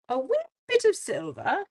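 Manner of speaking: light Irish leprechaun lilt; lightly playful Irish leprechaun voice. Pretend. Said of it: "it sounds like a leprechaun".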